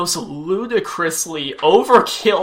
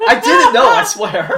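vehement denial; 5 semitones higher, 8 dB louder than usual. Real, not acted. Denying the intended pun.

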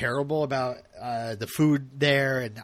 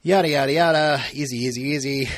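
ordinary delivery

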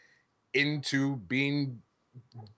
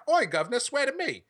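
British Batman; 10 semitones higher than usual. Retold or imitated. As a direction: ham it up as a cockney Batman. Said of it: "if he's British and he's like".

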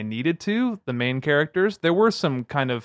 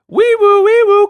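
loud high siren call; siren imitation; 11 dB louder than usual. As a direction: wail it like a police siren. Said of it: "like I said".

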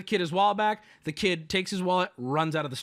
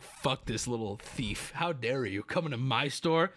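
low angry scorn; 5 semitones lower, 5 dB quieter than usual. Retold or imitated. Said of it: "my dad is just like".

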